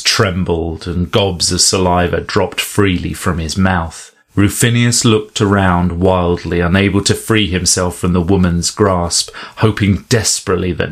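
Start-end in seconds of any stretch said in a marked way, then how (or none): none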